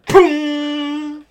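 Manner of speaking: loud high mock explosion sound; security camera audio. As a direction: shout it like a crash on a recording. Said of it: "I'm just gonna go ahead and play that now".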